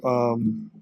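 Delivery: usual speaking voice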